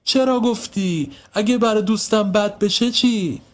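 high, drawn-out reproach; stamp-your-foot protest, pitched high; hurt child telling off a tattletale; upset and cross. A narrator's reading. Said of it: "از شدت دلخوری پایش را".

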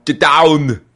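Austrian action-hero bark; Arnold accent. Pretend. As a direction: shout it like Schwarzenegger. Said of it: "So you get an Arnold accent".

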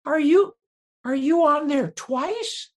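high, slow, puzzled fan voice; confused fan. Retold or imitated. Fan asking the comedian about his name, in a high puzzled voice. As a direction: squeak it out like a baffled fan. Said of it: "my fans, they're great people".

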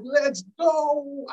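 high, thrilled whoop; excited cheer. Retold or imitated. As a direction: cheer the interception like beating your chest. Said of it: "I'm like".

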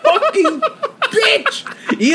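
high, very slow laughing insult; laughing drawn-out curse. Real, not acted. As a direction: stretch it out laughing. Laughing rant at Siri.

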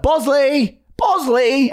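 high-pitched call to a runaway dog; annoyed calling. Retold or imitated. Owner calling his runaway dog, annoyed high call. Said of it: "I'd be like, annoyed because I'd be like".

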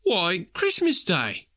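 slow, high, matter-of-fact; boy replying. A narrator's reading. The boy in Sunday clothes telling Scrooge it's Christmas. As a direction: answer the old man plainly.

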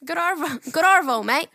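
mock Australian accent. Pretending to greet diners in Australia, mock Australian accent. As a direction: put on a playful Aussie accent. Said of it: "restaurant in Australia and they say".

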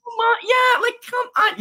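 very high incredulous protest; high-pitched scoffing outburst; exasperated disbelief. Real, not acted. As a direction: protest in a squeal as if nobody could believe it. Incredulous at staged phone scene.

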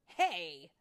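loud, sudden objection; indignant protest. Acted. Vidia to Tinkerbell crashing into her, loud and indignant. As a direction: shout at someone barging in.

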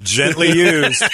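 drawn out like a used-goods ad; deadpan joke. Acted. Joke about the used gift.

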